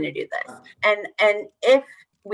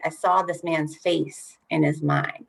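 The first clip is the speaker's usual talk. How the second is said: slowly explaining, stress on "saw"; walk them through it slowly; slow paraphrase; 2 semitones lower, slower than usual. Shown as a demonstration. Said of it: "that's kinda weird".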